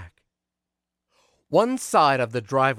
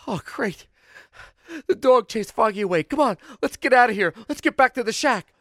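higher, louder squeaky relief; relieved mice; 12 semitones higher, 6 dB louder than usual. Acted. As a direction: hurry your friend home. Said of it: "They said".

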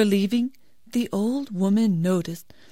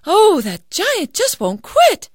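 put-on high, slow annoyed girl; fed-up young heroine; exasperated daughter; 12 semitones higher, 6 dB louder, slower than usual. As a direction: complain while running.

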